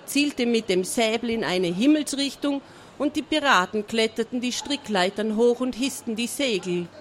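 ordinary delivery, nothing marked

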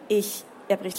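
usual speaking voice

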